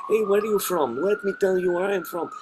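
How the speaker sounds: put-on high, Russian-accented Zelensky voice; Zelensky impression. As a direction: ham up a Ukrainian accent. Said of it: "if you do Zelensky".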